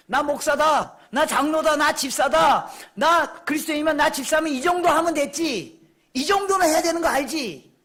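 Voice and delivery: loud, high, emphatic proclamation; bold declaration. Acted. Believer boasting before the world of being pastor or deacon, bold declaration. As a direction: declare it forcefully, as a challenge.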